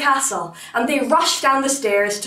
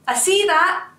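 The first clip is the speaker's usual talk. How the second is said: quick higher-pitched acknowledgement; undaunted tailor; 8 semitones higher than usual. A narrator's reading. Tailor answers the monster's challenge, lightly undaunted. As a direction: pipe up as the busy tailor, lightly acted.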